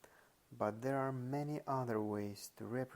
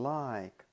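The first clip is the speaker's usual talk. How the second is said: "like" punched loud, then falling; stress on LIKE; 6 dB louder than usual. Shown as a demonstration. Lecturer showing an emphasized word, with stress on "like". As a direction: hit "like" hard. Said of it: "is emphasized".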